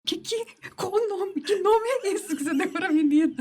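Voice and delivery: high, startled disbelieving father; father demanding what name this is; father's outraged question. Retold or imitated. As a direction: play the shocked dad, voice jumping up. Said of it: "Aí ele, Amadeu".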